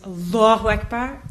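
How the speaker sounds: normal talking voice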